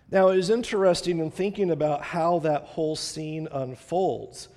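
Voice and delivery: ordinary talk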